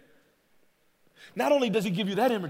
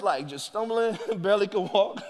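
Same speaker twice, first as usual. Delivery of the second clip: ordinary delivery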